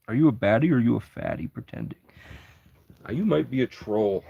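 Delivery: usual speaking voice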